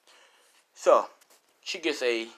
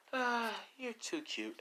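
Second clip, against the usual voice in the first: mock cooing; 12 semitones higher, 7 dB quieter, slower than usual. Girl cooing mockingly.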